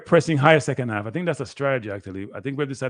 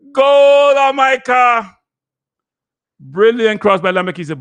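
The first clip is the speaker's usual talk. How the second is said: ecstatic goal shout, much higher and louder; goal celebration; 11 semitones higher, 11 dB louder, slower than usual. Real, not acted. Fan commentator erupting at the Jamaica goal, ecstatic shout. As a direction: scream the goal call with everything.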